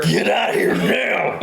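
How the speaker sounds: drawn-out, hammed-up order; mock aggressive cashier. Hurried cashier to lingering shopper, mock aggressive. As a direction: shoo a customer off, mock fierce.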